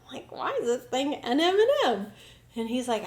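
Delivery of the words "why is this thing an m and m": puzzled. Retold or imitated. Puzzled question to the therapist.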